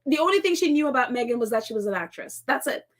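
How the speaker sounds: usual speaking voice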